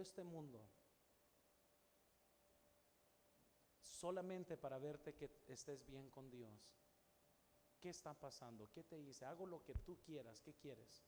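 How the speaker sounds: a father softly begging his daughter to open up, fading to near silence; soft pleading to daughter. Retold or imitated. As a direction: beg her gently, almost in tears.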